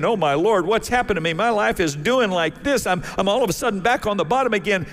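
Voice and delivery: a man in a cell, pleading; despairing Joseph. Retold or imitated. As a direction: voice rising high in anguished prayer.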